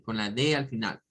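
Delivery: ordinary talk, nothing marked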